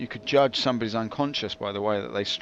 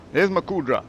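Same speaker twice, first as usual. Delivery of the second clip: pleased call; 5 semitones higher than usual. Real, not acted.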